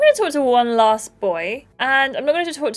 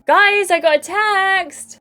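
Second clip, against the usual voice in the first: excited call out; 10 semitones higher, 4 dB louder, slower than usual. Acted. Em announcing a text to everyone.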